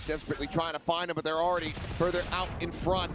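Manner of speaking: rising play-by-play call; esports caster. Real, not acted. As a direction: call the action as it builds.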